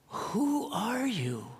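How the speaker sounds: put-on high, slow, wondering child's voice; awed little boy. Crying boy asking the stranger who gave him money, in a high, awed, slow voice. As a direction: ask it in wide-eyed awe.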